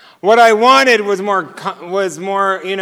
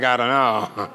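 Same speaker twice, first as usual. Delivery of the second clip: clueless shrug; 8 semitones lower, 6 dB quieter than usual. Retold or imitated. Clueless man answering every question.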